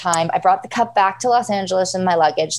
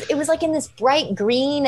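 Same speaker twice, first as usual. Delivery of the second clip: ordinary talk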